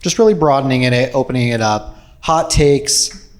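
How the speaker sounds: normal talking voice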